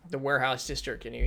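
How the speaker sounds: ordinary talk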